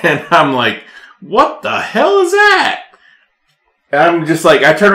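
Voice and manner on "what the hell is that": high, slow shocked cry; a startled, drawn-out shriek at the skull. Retold or imitated. Sees skull, retold shock.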